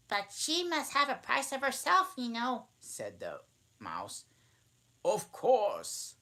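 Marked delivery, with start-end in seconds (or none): 0.1-2.7 very high, fast mouse squeak; squeaky mouse voice. Acted. Mouse speaks. 5.0-6.2 high, loud mock-grave reply. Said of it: "replied very gravely".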